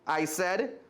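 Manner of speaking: expressive read-aloud storytelling; lively storybook reading voice; story narration. A narrator's reading. Reads a children's story aloud.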